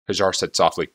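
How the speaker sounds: ordinary delivery, nothing marked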